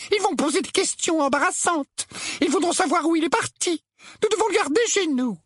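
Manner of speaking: high, brittle insistence; brusque. A narrator's reading. Aunt Petunia overruling her husband about Harry, brusque. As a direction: lay down each reason curtly in a high voice. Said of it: "sa brusquerie habituelle et ses manières cassantes".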